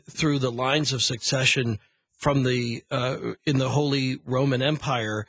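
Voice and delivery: ordinary delivery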